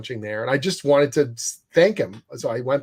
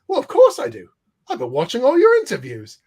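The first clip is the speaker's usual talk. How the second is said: high, eager, louder voice; Richard's eager reply; 10 semitones higher, 5 dB louder than usual. Retold or imitated. Richard assuring Jim he knows him, high and eager. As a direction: gush it back in a raised voice.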